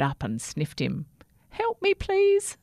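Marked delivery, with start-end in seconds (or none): 1.5-2.6 very high, slow, frightened plea; pleading. A narrator's reading. Little toothbrush begging a big rat for help. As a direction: beg like a tiny lost thing.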